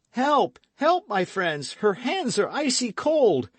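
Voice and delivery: loud, high call for help; desperate cry. Acted. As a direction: shout for your friends in panic. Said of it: "cried D'Artagnan".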